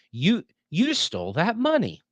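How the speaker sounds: "You, you stole that money" stammered high in disbelief; shocked accusation. Shown as a demonstration. Teacher demonstrating a shocked accusation, pitched high. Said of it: "That's one way of saying it".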